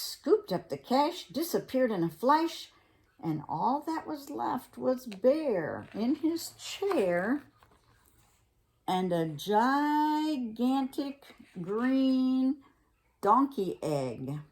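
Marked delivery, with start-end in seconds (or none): none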